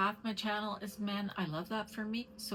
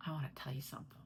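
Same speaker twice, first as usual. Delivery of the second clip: whisper; 5 semitones lower, 6 dB quieter, much faster than usual. Real, not acted. Sharing a secret.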